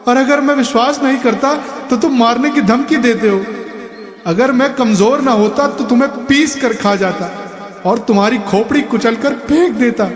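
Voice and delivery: put-on high, whiny, weepy old man; high weeping complaint with empty threats; tearful rant. A narrator's reading. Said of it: "उसका रोना रुका नहीं".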